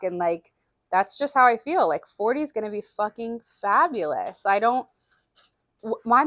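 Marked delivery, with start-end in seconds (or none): none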